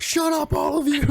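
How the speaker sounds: high-pitched burst of exasperation; mock yell. Agent silencing the doubting players, high mock yell. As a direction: imitate an agent snapping at everyone, high.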